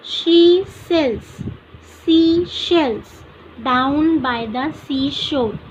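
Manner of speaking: very slow, each word spaced out for the class; teacher reading the tongue twister for students, very slow; slow example reading. Shown as a demonstration. Teacher reads example sentence aloud, slow and deliberate. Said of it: "for example next देखो".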